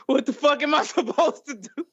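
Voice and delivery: high, helpless laughing disbelief; retold outrage dissolving into laughter; laughing exasperation. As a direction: crack up while asking what on earth to do. Laughing retelling of own exasperation.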